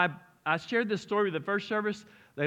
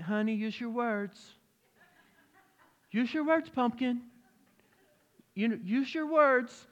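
higher, slow, sugary coaxing mother; sweet mom; 6 semitones higher than usual. Retold or imitated. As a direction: coax a tantrum gently. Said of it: "his mom's like".

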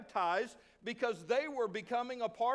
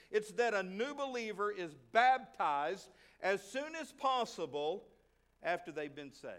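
ordinary delivery

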